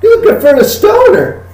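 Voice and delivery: very high, laughing buddy talk; giggly gun dealer. Acted. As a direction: chuckle and butter up the customer. Dealer repeats order.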